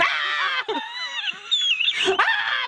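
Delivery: sudden high yelp while falling off the chair; real startled yelp. Real, not acted. Mom yelping as the shared chair tips over.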